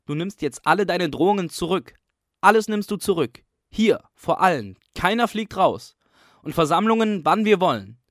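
loud, high triumphant demands; demanding shout. A narrator's reading. Pupil dictating terms to the caretaker, loud demanding shout.

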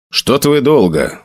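plain reading voice

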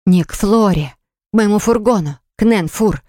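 ordinary delivery, nothing marked

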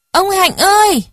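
put-on very high child's cry for help; child's loud call. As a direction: call out shrilly as a struggling child. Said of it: "Ái đẩy không ra được kêu to". (giọng trẻ con kêu to, the thé)

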